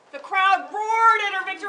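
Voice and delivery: loud, fast excited narration; roaring crowd. A narrator's reading. Narrator telling kids of the crowd's roar, shouting fast.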